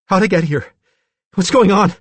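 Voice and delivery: high, loud, frantic questions; bewildered, frightened character voice; panicked confusion. A narrator's reading. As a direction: demand answers in rising alarm. Billy looks around wildly, confused.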